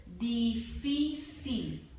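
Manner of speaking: "difícil" very slowly, weight on FÍ; stressed syllable demo. Shown as a demonstration. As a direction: split "difícil" into syllables, FÍ strongest. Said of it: "dónde está la fuerza de voz".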